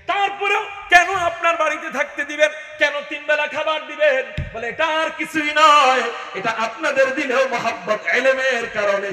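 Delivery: loud, high sermon climax; fiery preaching. Acted. Preacher rousing the crowd, fiery and loud. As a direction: rouse the crowd with full force.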